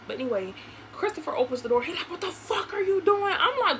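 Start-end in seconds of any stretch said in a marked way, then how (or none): 2.1-3.3 high, indignant mock demand; imitated angry demand. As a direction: act out his outraged challenge at the door. Acting out Christopher at the door.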